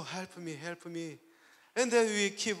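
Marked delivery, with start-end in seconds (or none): 0.0-1.2 low, hushed repeated plea; murmured prayer for help; soft, re-enacted begging to God; praying. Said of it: "So I start to pray".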